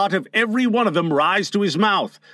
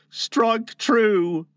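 high, measured, steady command; firm; 6 semitones higher than usual. Acted. Tootles offers himself to Peter, firm. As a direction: hold firm on both words. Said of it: "he said firmly".

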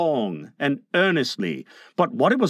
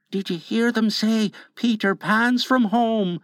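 high, hushed henchman; lightly mimed stage whisper, pitched high; whispering Smee; 6 semitones higher than usual. A narrator's reading. Smee reports the overheard news to Hook, hushed and high. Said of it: "Smee whispered".

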